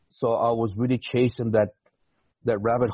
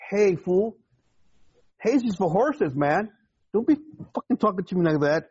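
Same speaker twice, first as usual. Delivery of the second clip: high-pitched, indignant retort; retold chief's dressing-down; 9 semitones higher than usual. Sailor telling off his young supervisor, retold angry chief. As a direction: tear into him, retelling it. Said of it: "I completely went, you know, chief on his, on his ass".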